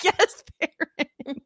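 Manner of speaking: slow, laughing, high yes Farron; laughing cheer. Retold or imitated. Fan cheering a character while recapping, laughing. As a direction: cheer her on through a laugh. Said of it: "I'm like".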